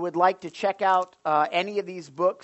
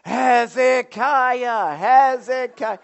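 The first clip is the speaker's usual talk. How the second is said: loud, high, drawn-out chant of a name; imitated crowd yelling; 8 semitones higher, 10 dB louder, much slower than usual. Pastor imitating school crowd picking who gets pied, yelling. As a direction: yell it slowly like a chanting crowd. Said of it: "And they're all yelling".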